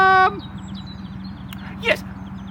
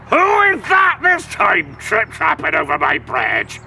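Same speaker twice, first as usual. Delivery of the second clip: lower, shouted troll challenge; angry troll shout; 9 semitones lower than usual. Acted. Troll demanding who crosses his bridge, low shout. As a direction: bellow it from under the bridge.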